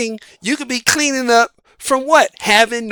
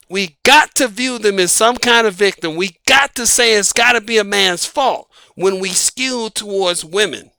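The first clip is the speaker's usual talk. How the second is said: usual speaking voice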